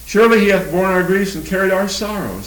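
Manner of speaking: measured, solemn Bible reading; scripture reading. Acted. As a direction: read the verses out to the congregation. Quotes Isaiah.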